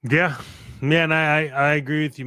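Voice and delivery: ordinary talk, nothing marked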